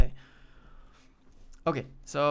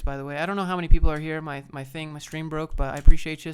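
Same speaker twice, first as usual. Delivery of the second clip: ordinary talk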